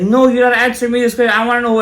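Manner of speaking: high, loud imitated protest; insistent demand. Owner refusing to let the rabbi off, insistent imitation. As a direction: cut in with a fast, pushy mock demand.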